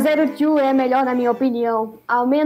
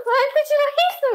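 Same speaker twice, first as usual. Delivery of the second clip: laughing; 12 semitones higher than usual. Real, not acted. Laughing while speaking.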